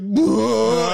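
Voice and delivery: high, loud crying wail; mock breakdown wail. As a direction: burst into a fake sob. Breaking down on the phone.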